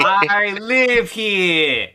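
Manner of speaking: high, loud, very slow Brooklyn bellow; a joke Brooklyn shout, drawn out; mock New York yell. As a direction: ham up an angry New Yorker.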